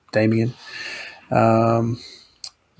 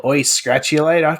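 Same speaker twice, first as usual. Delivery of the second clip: put-on bogan Aussie accent; 4 semitones higher than usual. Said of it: "put on an accent".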